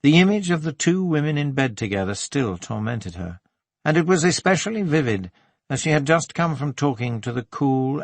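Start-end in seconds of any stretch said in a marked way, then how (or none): none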